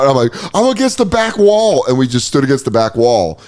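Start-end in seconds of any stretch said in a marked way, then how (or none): 0.5-1.8 high, urgent panicky report; mock panic. Tripping man telling his friend where he is, high mock panic. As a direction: blurt it out in comic desperation.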